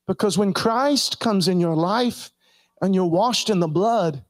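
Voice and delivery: normal talking voice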